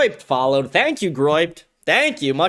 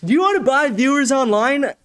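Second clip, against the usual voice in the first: much higher, mocking spam-bot voice; mocking spammer; 8 semitones higher than usual. Streamer mocking a chat spammer, high and silly. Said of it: "He's like".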